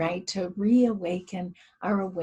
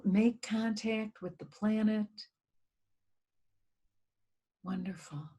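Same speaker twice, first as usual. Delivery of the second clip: guided meditation. Acted. Leading listeners in a guided earth meditation, calm and hushed. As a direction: ease listeners inward, letting phrases fade to near whisper.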